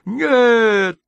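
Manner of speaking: high, loud howl of refusal; a desperate howling no. A narrator's reading. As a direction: wail the word out. Repeated howled refusal.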